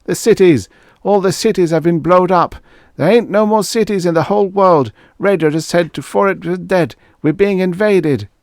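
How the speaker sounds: high, loud panicked shouting. Acted. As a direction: yell the alarm as you burst into the barn. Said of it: "when Pa had come running to the barn shouting".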